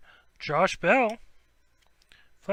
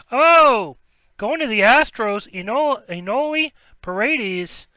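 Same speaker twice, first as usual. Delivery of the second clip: excited pull; 4 semitones higher, 8 dB louder than usual. Real, not acted. Another hit pulled live.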